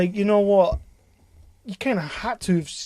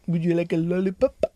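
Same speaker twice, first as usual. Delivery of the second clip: mock German accent. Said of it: "That's what Germans say".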